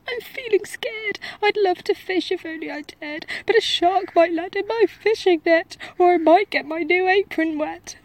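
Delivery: high, loud trembling cook voice; scared cook. A narrator's reading. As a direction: babble your fears like a terrified wimp. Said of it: "the cook declared".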